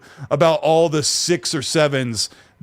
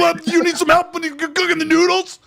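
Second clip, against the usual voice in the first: mock shouting, pitched way up; headphone voice; 14 semitones higher than usual. Dad asks family about dinner, oblivious headphone-voice shout. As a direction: holler as if wearing a headset. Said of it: "like, headphone voice when I go in the house".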